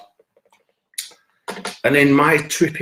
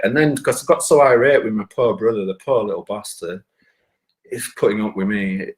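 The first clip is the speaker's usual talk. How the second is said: normal talking voice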